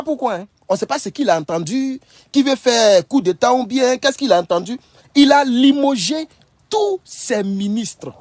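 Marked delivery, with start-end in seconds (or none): 5.1-8.1 slow dramatic emphasis. Real, not acted. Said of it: "emphatic slow announcement".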